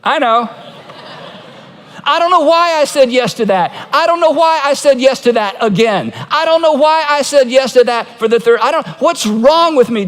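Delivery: mild mock exasperation; building exasperation. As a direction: pile up the self-blame. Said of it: "all your friends say".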